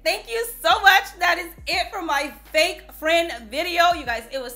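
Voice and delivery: high, loud cheerful outro; excited sign-off. Real, not acted. Thanking viewers at the end of the video, loud and bright. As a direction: wrap up the video with a burst of energy.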